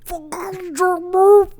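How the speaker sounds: high cartoon dog voice snapping; squeaky goofy brush-off. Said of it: "He's like".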